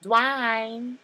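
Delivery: high, loud Southern-belle call of Dwayne; a put-on Whitley Gilbert accent calling her man. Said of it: "a little bit of Whitley Gilbert".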